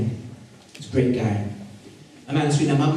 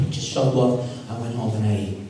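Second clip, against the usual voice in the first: usual speaking voice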